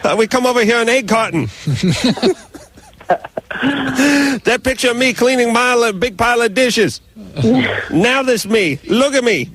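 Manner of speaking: put-on high, heavy Vietnamese-accented pitchman voice; hammed-up Vietnamese infomercial guru bragging; Tom Vu impression. Radio host mocking Tom Vu, showing off his riches in a high put-on voice. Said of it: "Tom Vu is Vietnamese".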